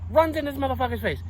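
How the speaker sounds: normal talking voice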